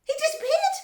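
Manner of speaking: high, very loud shriek; yelling. A narrator's reading. Mother losing sight of her son, yelling. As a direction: shriek it in alarm. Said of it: "yelled Missus Gloop".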